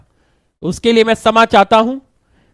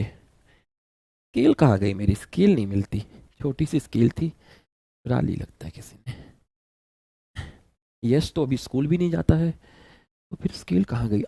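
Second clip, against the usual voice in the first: muttering to himself; 8 semitones lower, 11 dB quieter than usual. Real, not acted. Teacher hunting for his lost ruler, muttering to himself. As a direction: grumble under your breath while searching.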